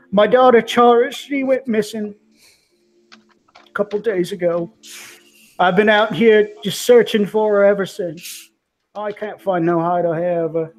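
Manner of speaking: put-on a father explaining his missing girl, desperate; distraught father.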